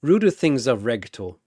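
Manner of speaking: plain reading voice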